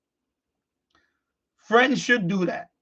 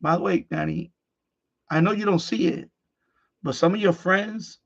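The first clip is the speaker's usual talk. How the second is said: ordinary delivery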